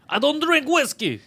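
high, drawn-out indignant protest; mock offended. Fighter taking offence at a whiskey offer, mock indignant. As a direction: act out an affronted refusal. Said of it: "McGregor just offered him whiskey".

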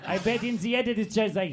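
normal talking voice

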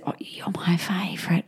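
put-on soft whisper of a little girl; whispered child. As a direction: breathe it like a secret. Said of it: "if Summer says".

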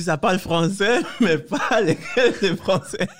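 joking through giggles; laughing. Real, not acted. Joking about the unexpected French.

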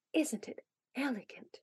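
hushed, very soft whisper; subdued whisper. A narrator's reading. Polly admires the secret tree, in a subdued whisper. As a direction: whisper it so nobody overhears. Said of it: "she asked in a subdued whisper".

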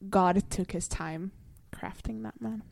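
slow, hushed whisper of admiration; reverent, drawn-out whisper; swooning whisper. Real, not acted. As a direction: whisper it slowly, savouring each word. Swooning over a series star.

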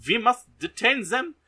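high, loud hammed-up German officer voice; Colonel Klink. Colonel Klink orders the English spies detained in a hammed-up high, loud German officer voice. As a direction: bark it like a cartoonish German colonel. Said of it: "And then Colonel Klink goes".

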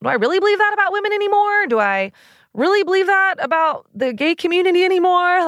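high, fast self-questioning voice; mock inner questioning. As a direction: rattle off the doubts high and fast.

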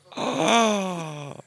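sharp loud gasp of shock. Real, not acted. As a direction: gasp in horror. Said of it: "Oh, that's horrible".

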